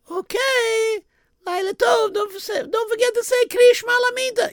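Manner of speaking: high, sweet mother's voice; a Yiddish mama asking gently. Acted.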